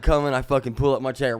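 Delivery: ordinary delivery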